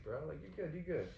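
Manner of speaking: ordinary delivery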